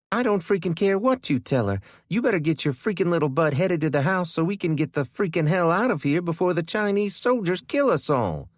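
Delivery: high, loud, fast furious rant; angry yelling. A narrator's reading. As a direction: sister blowing up in panic. Kendra keeps yelling.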